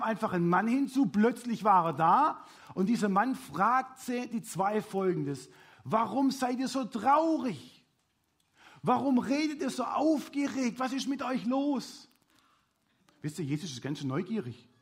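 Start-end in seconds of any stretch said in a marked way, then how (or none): 5.8-12.1 raised, slow, emphatic questioning; lightly acted concerned questions; questioning stranger. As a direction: ask it higher, drawing the words out. Preacher voices the stranger's questions.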